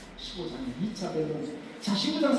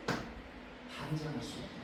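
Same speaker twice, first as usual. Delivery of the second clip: hushed whisper; 4 semitones lower, 13 dB quieter than usual. Real, not acted. Warning church not to judge.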